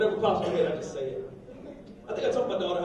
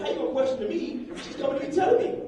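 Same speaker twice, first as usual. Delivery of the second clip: indignant, climbing steadily higher; getting mad; 8 semitones higher than usual. Retold or imitated. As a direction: build from irritation to high-pitched protest. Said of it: "I'm starting to get mad".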